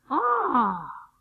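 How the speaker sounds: loud, high theatrical "ah"; hammed-up cry of someone falling on purpose; mock falling cry. Preacher mimics artists of the faith falling, loud fake cry.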